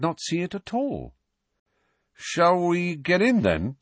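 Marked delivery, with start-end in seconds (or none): 2.1-3.8 higher, slow and hesitant; a tentative, worried suggestion; uncertain. A narrator's reading. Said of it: "said Ron uncertainly".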